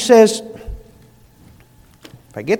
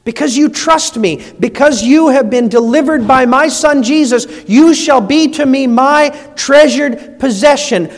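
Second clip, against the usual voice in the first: higher and louder, ringing out; proclaiming; 4 semitones higher, 6 dB louder than usual. Shown as a demonstration. Preacher voicing God to the congregation, ringing proclamation. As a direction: announce it with joy to the room. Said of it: "God says that to us".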